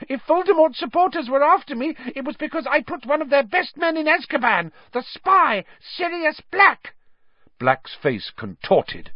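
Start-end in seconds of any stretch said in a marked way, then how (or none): 0.1-6.9 very high, loud squeal; Pettigrew, accusing. A narrator's reading. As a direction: shrilly throw the blame at an accuser.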